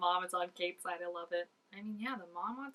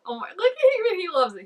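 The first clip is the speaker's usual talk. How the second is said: much higher and louder, delighted; excited squeal; 11 semitones higher, 13 dB louder than usual. Real, not acted. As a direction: gush with delight. Excited reaction to scene.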